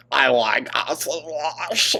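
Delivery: teasing parrot of her line; mocking imitation. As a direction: repeat it back in a mocking voice. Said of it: "laughs, mocking repeat".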